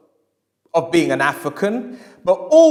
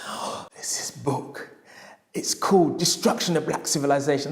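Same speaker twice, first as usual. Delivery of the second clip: hushed whisper; 2 semitones lower, 6 dB quieter than usual. Retold or imitated. Customer secretly eyeing forbidden book.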